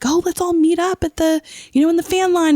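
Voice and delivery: high, chirpy mock invitation; eager fan friends. Online friends suggesting a meetup, chirpy and high. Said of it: "all my groups were like".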